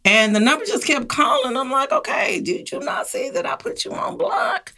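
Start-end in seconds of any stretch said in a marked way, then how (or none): 1.9-4.7 high, breathy exasperated whisper; retelling her fed-up reaction to a caller who won't stop, whispered; exasperated aside. Retold or imitated. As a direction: hiss it like you can't believe they're still calling. Caller ignores the block.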